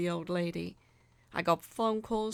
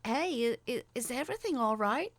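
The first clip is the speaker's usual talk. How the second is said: higher, fast stammering voice; hesitant question; 5 semitones higher than usual. A narrator's reading. Narrator asking a passing nurse, stammering.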